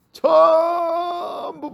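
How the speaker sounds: high, slow, crying shout. Acted. Preacher voicing the lottery crowd, crying shout. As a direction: cry it out for everyone buying lottery tickets.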